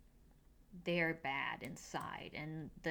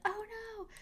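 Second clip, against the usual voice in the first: mock dismay. [name] voiced mock dismay.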